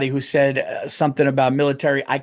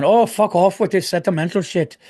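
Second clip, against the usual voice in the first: mock Irish accent, higher, brushing it off; Irish guy; 4 semitones higher than usual. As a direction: imitate a grumpy Irish listener in an Irish accent. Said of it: "Irish guy going".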